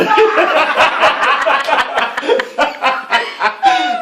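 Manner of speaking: long, high, loud burst of laughter. Real, not acted. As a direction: crack up hard. Laughing at his own story.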